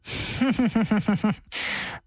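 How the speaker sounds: tipsy staccato giggle; a run of short, acted boozy chuckles; drunken chuckle. Drunk man laughing at the judge's remark, tipsy chuckle. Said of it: "宗离舌头僵硬地说".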